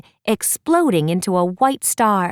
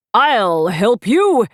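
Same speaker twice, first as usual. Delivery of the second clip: slow, louder hero vow; a hammed-up heroic promise; heroic cry; 3 semitones higher, 5 dB louder, much slower than usual. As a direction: call it out bravely, stretched. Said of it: "Hunter cried".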